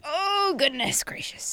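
high, drawn-out groaning "oh" that slides down; old person sitting down. Retold or imitated. Imitating old people lowering themselves into a chair, old-person groan. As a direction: put on an old person's effortful exclamation. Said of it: "you know how old people, when they sit down".

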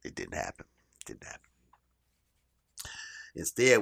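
usual speaking voice

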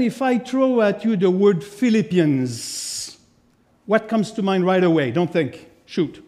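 usual speaking voice

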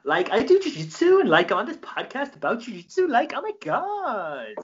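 high, bubbly Valley girl imitation; mock him like a chatty Valley girl. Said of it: "Just like that".

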